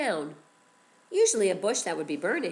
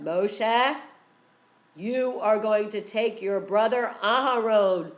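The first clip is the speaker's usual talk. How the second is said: divine voice; 3 semitones higher, 11 dB louder than usual. A narrator's reading. Hashem answers Moshe.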